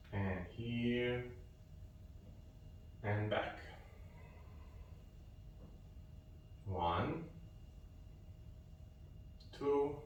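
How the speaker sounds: quiet, slow exercise cues with long pauses; soft-spoken instructor pacing a qigong stretch; soft guided counting. Acted. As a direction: count them gently through the movement.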